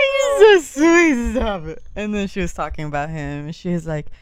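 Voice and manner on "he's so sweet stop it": high, loud, slow gush. Retold or imitated. Answering the coworker about her son, loud high gush. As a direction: gush with delight. Gushing reply.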